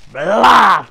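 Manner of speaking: loud, high impact noise; a big smacking sound effect; imitated blow. Storyteller imitating the sergeant's blow, loud impact noise.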